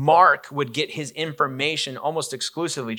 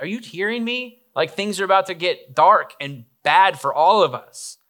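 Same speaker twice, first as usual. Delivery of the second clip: exasperated Jesus; 6 semitones higher, 4 dB louder than usual. Acted.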